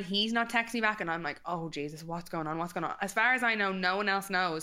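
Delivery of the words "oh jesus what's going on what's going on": low, quiet retold fretting; anxious muttering. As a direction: fret under your breath.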